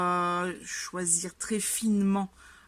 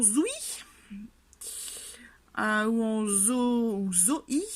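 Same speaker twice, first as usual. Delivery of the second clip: American-style Zoé; 3 semitones higher, slower than usual. Shown as a demonstration. Reader mocking the audiobook's American Zoé. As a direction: say Zoé as an American narrator would. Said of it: "Ils le disent à l'américaine".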